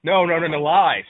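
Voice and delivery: loud, insistent rebuttal; loud denial. Real, not acted. Host defending the Wii against friends, loud denial. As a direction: shout down the accusation.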